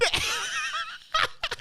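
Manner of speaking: shrieking laugh; real shrieking laughter; high laughter. Real, not acted. Host cracking up.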